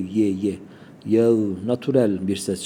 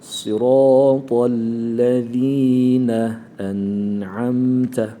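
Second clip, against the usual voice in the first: chanted very slowly, drawn out; slow recitation; 6 dB louder, much slower than usual. Shown as a demonstration. Recitation demo.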